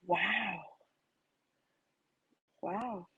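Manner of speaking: a breathless, disbelieving wow, dropped very soft; emphatic wow. Retold or imitated. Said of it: "you say it with so much emphasis".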